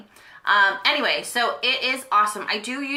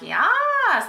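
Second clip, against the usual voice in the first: drawn-out, high-pitched mock cheer; excited yes; 12 semitones higher, 3 dB louder than usual. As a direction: let out a long, high "yes". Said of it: "I was like".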